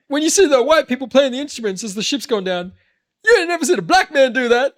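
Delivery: high, loud joking voice; comedian impression. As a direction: deliver the joke big and loud. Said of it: "he's like".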